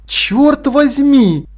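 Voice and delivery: high, drawn-out amazement; delighted exclamation. A narrator's reading. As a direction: exclaim it in awed admiration. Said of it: "восхищённо воскликнул Тепик".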